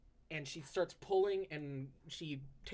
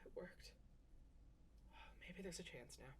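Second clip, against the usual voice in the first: soft, breathy sighed relief; sighing relief; 17 dB quieter than usual. Acted. Exhausted elf to herself after success, soft sighed relief. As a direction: breathe it out, barely voiced.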